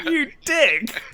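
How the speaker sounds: high, laughing you dick; mock insult. Podcaster jeering at the character, laughing and high. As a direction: jeer at the character through a laugh.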